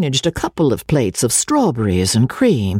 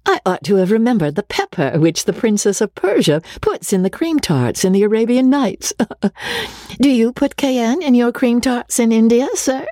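much higher, playful mock-innocence; comical air; 10 semitones higher than usual. Rebecca teasing old Sedley about the pepper, comical. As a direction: tease him lightly with wide-eyed humor. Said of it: "said, with a comical air".

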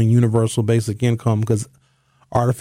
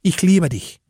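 harsh German, a touch higher; menacing German; 5 semitones higher than usual. Shown as a demonstration. Host contrasts English and German love, menacing German. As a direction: spit it out like a threat. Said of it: "sounds like they're about to kill".

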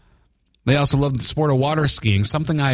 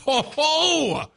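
playful ho-ho laugh; 12 semitones higher than usual. Real, not acted. Laughing at clock fact.

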